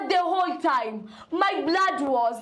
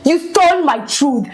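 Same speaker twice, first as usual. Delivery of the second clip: furious accusation; 5 dB louder than usual. Acted. Furious daughter.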